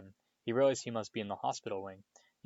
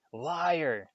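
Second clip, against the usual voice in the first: sharp high shout of accusation; 6 semitones higher, 3 dB louder than usual. A narrator's reading. Harry shouting back at the face. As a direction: fling the word out, high and loud. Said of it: "Harry shouted suddenly".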